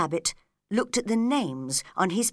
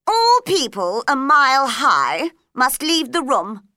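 louder, haughty royal decree; imperious Queen; 5 semitones higher, 8 dB louder than usual. Acted. Queen ordering Alice out, loud and haughty. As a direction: pronounce it as a ruling.

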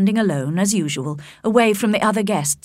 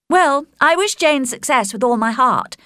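higher, emphatic friend's voice; well-wishing Charlotte; 6 semitones higher, 4 dB louder than usual. A narrator's reading. Charlotte talking to Elizabeth of Jane's success, wholehearted. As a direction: wish her well wholeheartedly.

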